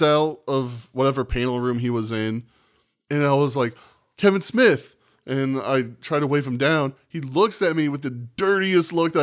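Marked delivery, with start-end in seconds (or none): 4.2-5.0 higher, louder call across a crowd; eager fan hailing a celebrity, calling his name. Retold or imitated. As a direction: shout his name to wave him down.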